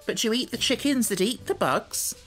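high-pitched hen's retort. A narrator's reading. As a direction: snipe back as a talking hen. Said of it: "retorted the yellow hen".

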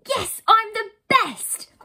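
high, loud, drawn-out boast; triumphant cheer. Acted. Friend celebrates winning hide and seek, triumphant cheer. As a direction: cheer your own victory loudly. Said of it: "Ella cheered".

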